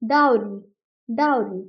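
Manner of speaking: डाउरी with an "ow" glide, falling on ree; correct pronunciation demo. Shown as a demonstration. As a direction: model डाउरी twice as DOW-ree. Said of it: "डब्ल्यू की pronunciation as यू आ जाती है".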